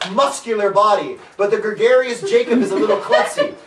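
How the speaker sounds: usual speaking voice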